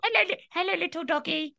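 high sing-song voice for a puppy; baby talk to a dog. Shown as a demonstration. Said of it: "speaking to animals in a certain voice".

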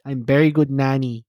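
put-on slow broken-English Filipino accent; mock Filipino accent. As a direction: play the eager Filipino nanny, slowly. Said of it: "I'm from the Philippines".

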